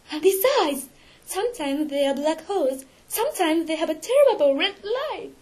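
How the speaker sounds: high, horrified description; tearful distress. A narrator's reading. Christine describing his terrible eyes to Raoul, distressed. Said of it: "She began to cry".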